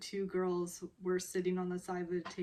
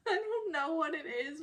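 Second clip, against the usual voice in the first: laughing through it; 10 semitones higher than usual. Retold or imitated. Teller gushing about cute scene.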